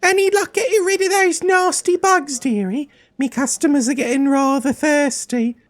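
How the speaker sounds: very high-pitched tavern keeper; high put-on landlady voice calling you dearie; Grisella. Tavern keeper asking about her bug problem, high-pitched character voice.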